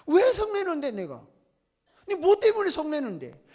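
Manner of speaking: high, loud start dropping at the end; mock indignant questioning; acted challenge. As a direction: demand an explanation as if scolding. Acting out God challenging Jonah.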